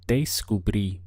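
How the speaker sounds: Descubrí with the stress on the final í; slow model pronunciation; Spanish word, careful. Shown as a demonstration. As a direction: land hard on the ending of Descubrí. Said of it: "Notice that we have an accent over the I".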